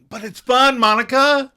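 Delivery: put-on high, loud playful English accent. Ross pushing the English accent on Monica, high and loud. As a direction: tease her with the accent. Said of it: "Don't do the accent".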